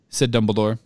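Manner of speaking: ordinary delivery, nothing marked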